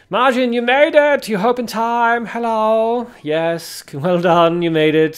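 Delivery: loud, high-pitched cheer of welcome; excited greeting. Real, not acted. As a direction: cheer the arrival, stretching the words out high. Greets late viewer arriving in time.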